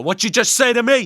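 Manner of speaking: loud, high Italian-American tough guy; Italian accent, confronting someone. Retold or imitated. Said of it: "my Italian accent".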